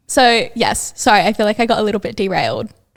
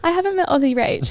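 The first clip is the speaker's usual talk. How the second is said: amused reply; 8 semitones higher than usual. Retold or imitated. Retold reply to the introduction.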